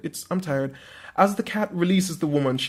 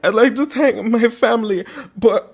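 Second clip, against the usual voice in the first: high, loud, gushing award winner; mock acceptance speech; 7 semitones higher, 7 dB louder than usual. Winner thanks the Academy, family and God, mock-overwhelmed. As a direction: play an overwhelmed Oscar winner over applause.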